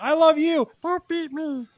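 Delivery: very high, begging little creature voice; high pleading penis voice. Pretend. Host voicing his penis begging not to be beaten, very high and squeaky. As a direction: squeak out a plea not to be hit. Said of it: "I'd be like".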